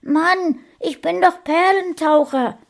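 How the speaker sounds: put-on very high child's voice, indignant; the son splutters back at his mother from the bathwater; spluttering little boy. A narrator's reading. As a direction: sputter it out like a kid who just surfaced. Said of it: "prustete der Kleine".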